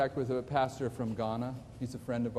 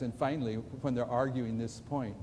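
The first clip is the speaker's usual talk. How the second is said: normal talking voice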